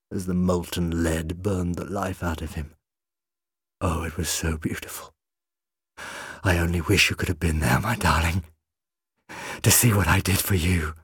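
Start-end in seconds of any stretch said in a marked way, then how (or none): 3.8-10.9 lightly voiced obsessed villain, swelling; McCavity, gloating madman. A narrator's reading. McCavity speaks to his wife's skeleton, lightly voiced and gloating. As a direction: croon it to your dead wife.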